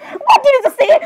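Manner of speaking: very high mock-outraged squeal; shocked demand. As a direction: shoot up high in disbelief. Acted shock at a whispered remark.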